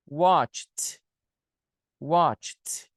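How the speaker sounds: "watched" as one syllable, "watch-t"; CH plus T ending. Shown as a demonstration. Teacher showing the difficult ending, "watched" as "watch-t". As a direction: click a T onto the CH of "watched". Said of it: "você tem que falar".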